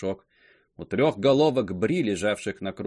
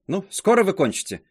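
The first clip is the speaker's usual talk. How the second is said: raised, louder impatient question; 9 semitones higher, 6 dB louder than usual. A narrator's reading. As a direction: hurry her up, losing patience. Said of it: "начинала терять терпение".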